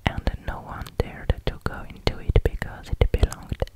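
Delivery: usual speaking voice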